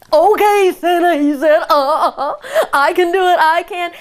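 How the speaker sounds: high, loud, giggly cub; thrilled little bear. A narrator's reading. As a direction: squeal with eager delight. Bear excited to go.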